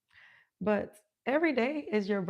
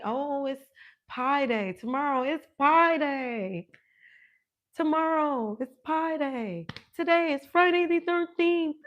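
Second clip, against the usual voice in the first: mock eager European; 6 semitones higher, 6 dB louder than usual. Host mocking people who wait for holidays, loud and high. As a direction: parody someone announcing holidays. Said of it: "Don't wait on the Europeans to tell you".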